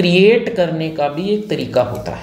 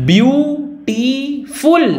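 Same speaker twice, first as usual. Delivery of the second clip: Beautiful high and drawn out in three beats; beautiful split into three high, separate syllables; 8 semitones higher, much slower than usual. Shown as a demonstration. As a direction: sound out beautiful slowly as BYOO, TEE, FUL. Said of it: "तीन syllable".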